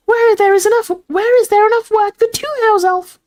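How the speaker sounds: very high, loud elf squeak; quoting house elf. A narrator's reading. Dobby retells the other elf's question, squeaky voice.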